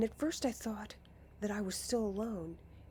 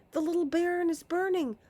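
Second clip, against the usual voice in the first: high loud cry, rushed faster; faster panicked cry; 9 semitones higher, 8 dB louder, faster than usual. Acted. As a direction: hurry the alarm out.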